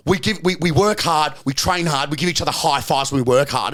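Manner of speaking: normal talking voice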